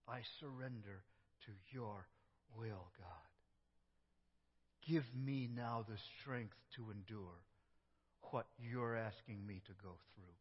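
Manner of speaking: soft, hushed yielding; a believer giving in to God's will; surrendering prayer. Acted. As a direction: let go quietly into trust.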